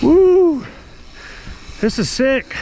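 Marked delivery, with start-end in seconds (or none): 0.0-0.6 high loud whoop of joy; excited whoop. Real, not acted.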